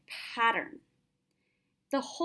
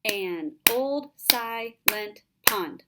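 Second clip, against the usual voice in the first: slow, each syllable spaced out; syllable counting; 5 dB louder, much slower than usual. Shown as a demonstration. Teacher reads the line slowly so students count five syllables. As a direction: tap out every beat slowly. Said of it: "Let's look at the syllables".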